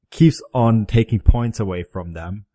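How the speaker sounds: usual speaking voice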